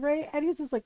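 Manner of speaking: normal talking voice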